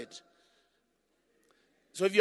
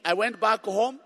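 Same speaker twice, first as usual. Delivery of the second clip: normal talking voice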